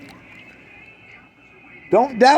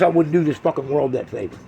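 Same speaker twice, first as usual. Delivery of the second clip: ordinary talk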